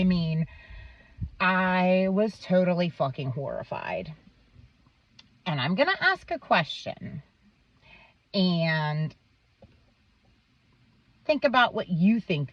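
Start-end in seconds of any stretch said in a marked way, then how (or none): none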